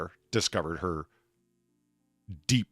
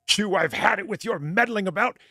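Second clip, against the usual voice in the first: a much higher, louder burst of rage at Picard; furious Q; 12 semitones higher, 5 dB louder than usual. Retold or imitated. Q snapping at Picard, louder and higher. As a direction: spit it out like a fed-up god.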